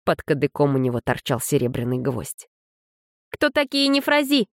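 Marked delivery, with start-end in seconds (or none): 3.3-4.5 high, sharp demand; demanding young woman. A narrator's reading. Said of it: "потребовала Кидан".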